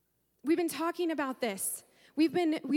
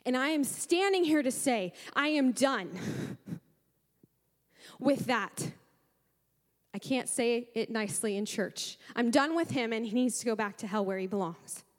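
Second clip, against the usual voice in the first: ordinary delivery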